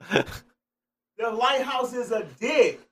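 loud, drawn-out epiphany; sudden excited realization. Real, not acted. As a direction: blurt it out loudly, stretching the words.

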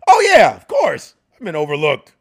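emphatic. Real, not acted. Comic asked if he's been overlooked, real emphatic outburst. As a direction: burst out with total conviction.

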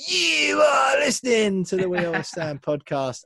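high, loud announcer holler with a long YOU; trademark intro shout. Shown as a demonstration. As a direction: belt it out like an over-the-top show opener. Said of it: "better than my".

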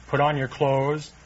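normal talking voice

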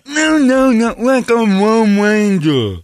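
put-on high, loud, slow kid voice; hammed-up kid; mock kid voice. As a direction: insist like a frustrated kid.

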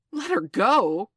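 slow, loud, incredulous shout; outraged cry. A narrator's reading. Hook hearing the captive was freed, crying out. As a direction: shout it back in disbelief. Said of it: "cried Hook".

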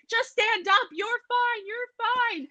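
high-pitched imitated parent yell; mock screaming. Parent yelling to a panicking kid in a pond, mock screaming. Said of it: "like, screaming and yelling".

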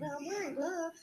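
high, smug mock defence. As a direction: parody a know-it-all glove wearer. Mocking careless glove wearers.

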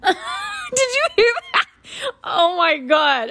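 high, slow, laughing "did you hear that", half shriek; real shock melting into laughter at a sudden noise; startled laughing disbelief. Real, not acted. Startled by a sound from her iPad.

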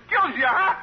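high, laughing shout of a comic. Acted. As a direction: crack yourself up while you yell it. Comedian laughing at own joke.

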